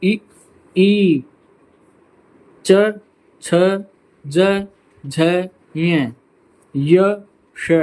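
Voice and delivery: teacher sounding out the palate letters इ ई च छ ज झ ञ य श; palatal sound demo. Shown as a demonstration. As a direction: show each palate sound clearly. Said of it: "आप देखेंगे".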